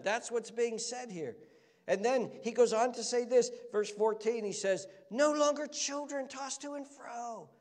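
high-pitched, urgent sermon delivery; impassioned preaching. Real, not acted. Preacher expounding scripture to the congregation, high and impassioned. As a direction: preach it with rising fervour and force.

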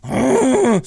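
loud, high mock-furious "oh"; exasperated roar. Man raging at his weed eater, exasperated roar. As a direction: let out a frustrated roar. Retells his rage at the machine.

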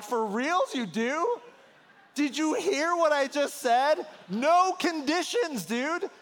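can't believe he just agreed to that; incredulous disbelief. Retold or imitated. Pastor silently challenging the groom at the altar, mock incredulous. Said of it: "And I was thinking".

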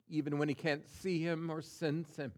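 ordinary talk, nothing marked

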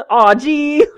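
high, laughing mock letdown; loud, high-pitched kid grumble through laughter; whiny kid complaint. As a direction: whine it like a disappointed child. Acted kid's reply to dinner.

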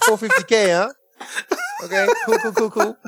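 high-pitched fake impressed; high mock impressed voice. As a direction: squeak out a mock okay cool. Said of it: "It's like".

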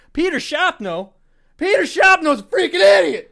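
high, loud, raging teacher scream; furious yell. Retold or imitated. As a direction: blow up at the kid's name like a furious teacher. Teacher raging at absent student.

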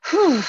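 loud high whew to steady herself; shaky exhale. Real, not acted. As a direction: blow out the tension before going on.

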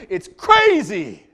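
slow, loud, high It's crazy; loud drawn-out crazy. Acted. Preaching how life suddenly changes, slow and loud. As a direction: blast it after the boring run.

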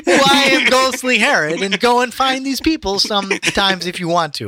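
high, loud wailing ghost with a weird Borat twist; ghostly Herod. Pretend. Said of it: "like a weird Borat thing".

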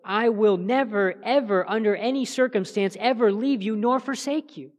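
preacher spelling out an unbreakable promise; emphatic reading. Shown as a demonstration. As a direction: stretch "never" and pile up the negatives. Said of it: "it would read something like this".

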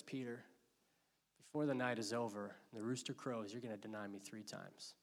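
soft, low, hushed foretelling; quiet warning. Acted. Jesus quietly warning Peter of the denial, low and hushed. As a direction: say it gently, almost under breath.

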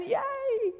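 a playful squeal of joy from the servant; mock cheer.

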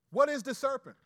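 suddenly loud and high; loud question. Real, not acted. Pastor restarting his teaching with a loud call. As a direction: burst out with the question.

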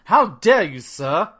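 loud, indignant mock British accent; outlandish British accent. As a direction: play an offended English gent in a hammed-up British accent. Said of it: "has, like, a outlandish British accent".

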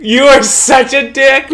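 high, loud, drawn-out protest; mock outrage. Real, not acted. Cohost scolding a friend over Rise of Skywalker, loud mock outrage. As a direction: come back at them loud and high, stretching it out.

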